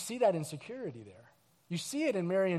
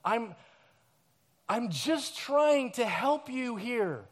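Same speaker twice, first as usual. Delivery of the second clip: tender voice of God; 2 semitones higher, slower than usual. Pretend. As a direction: gently coax someone out of the dark. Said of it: "a God that's saying".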